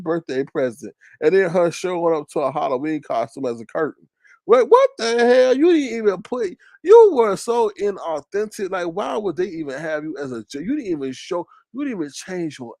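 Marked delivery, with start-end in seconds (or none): 4.5-8.7 louder, higher, incredulous; outraged disbelief. Real, not acted. To chat about the curtain costume, loud outrage. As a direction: call it out in disbelief. Calling out Face Yaya's costume.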